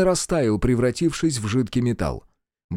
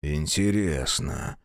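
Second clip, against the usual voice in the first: cold prince; 8 semitones lower, 6 dB quieter, much slower than usual. A narrator's reading.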